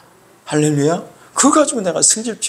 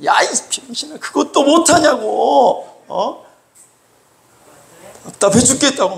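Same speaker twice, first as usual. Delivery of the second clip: high, exasperated outburst with stretched endings; hot-tempered yelling; 9 semitones higher than usual. Acted. Preacher shows temper coming before teaching, hot-tempered yelling. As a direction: berate them in a raised, frustrated voice. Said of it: "혈기가 있어가지고 일단 먼저 소리".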